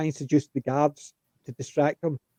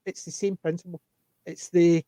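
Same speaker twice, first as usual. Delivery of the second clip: normal talking voice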